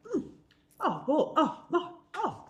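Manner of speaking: a flattered man swelling with pride; lightly voiced preening officer. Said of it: "he just sort of puffed his chest out".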